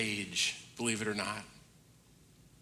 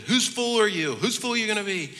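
higher, lively voicing of dad asking; dad's teasing challenge; 7 semitones higher than usual. A dad teasing his teenage son with his question. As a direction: voice my dad as he teases me. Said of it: "but he goes".

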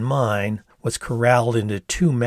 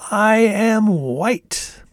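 slow, high and loud declaration; big theatrical reveal, high and stretched out; wide-eyed exclamation; 10 semitones higher, 5 dB louder, much slower than usual. A narrator's reading. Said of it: "stretching my eyes wide open".

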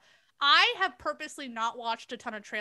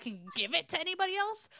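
ordinary talk, nothing marked

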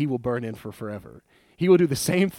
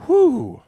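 whew; 11 dB louder than usual. Real, not acted. Relief after tense story moment.

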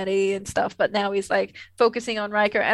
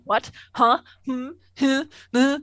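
Jonathan Frakes; 4 semitones higher, 4 dB louder than usual. Retold or imitated. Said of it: "Jonathan Frakes is just like".

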